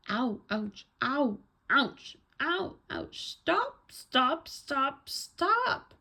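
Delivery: bouncing yelps climbing very high; loud, high acted cries of pain; yelling in pain. A narrator's reading. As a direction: yelp each word as if bumped along, building to high stops.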